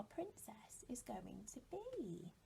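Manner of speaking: mysterious whisper. Acted. Teacher wonders aloud, building mystery.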